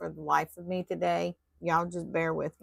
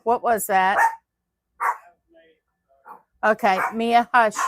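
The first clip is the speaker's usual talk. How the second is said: much louder, startled calling out; 4 semitones higher, 12 dB louder than usual. Real, not acted. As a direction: hush the dog from across the room. Startled by noise, shushing dog.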